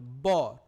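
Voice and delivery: ব as a loud B from closed lips; lips together B. Shown as a demonstration. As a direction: close both lips, then pop out ব. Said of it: "ঠোঁট লাগিয়ে উচ্চারণ করতে হয়".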